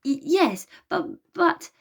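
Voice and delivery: high, nervous stammer. A narrator's reading. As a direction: stumble over the words in panic. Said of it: "stammered Virgo".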